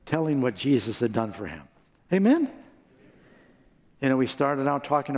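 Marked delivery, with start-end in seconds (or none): 2.1-2.5 rising call. Real, not acted. Preacher calls for response.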